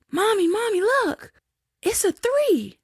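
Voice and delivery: high-pitched imitation of a little daughter; daughter's voice. Daughter calling to Mommy from the back seat, high imitated child voice. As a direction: put on an excited child's high voice. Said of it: "my daughter, who was in the back seat, said".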